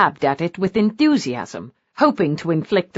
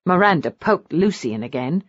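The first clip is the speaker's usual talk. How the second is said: ordinary delivery, nothing marked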